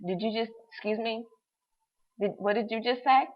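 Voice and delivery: hushed, stunned, can't believe it; stunned disbelief. Retold or imitated. To the storage caller, in quiet shock. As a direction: ask it half under your breath, floored. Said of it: "I'm holding the phone like".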